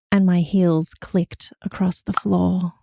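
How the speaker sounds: slow breathy whisper; sultry whisper. Pretend. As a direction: whisper it slowly and seductively. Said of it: "my sexy voice".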